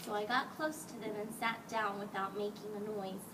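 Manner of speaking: plain reading voice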